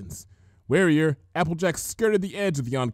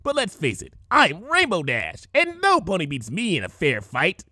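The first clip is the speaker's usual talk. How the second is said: higher brash boastful pony; Rainbow Dash boast; 4 semitones higher than usual. A narrator's reading. Rainbow Dash boasting to the changeling.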